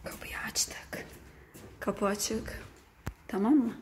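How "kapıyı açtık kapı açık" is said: breathless secret whisper; whispering while hiding. Real, not acted. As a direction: whisper so dad won't hear. Hiding from husband during prank.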